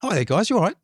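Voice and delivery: high, friendly mock officer; officer greeting the kayakers in a high, friendly voice; patrol officer voice. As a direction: put on a breezy high officer voice. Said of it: "he was like".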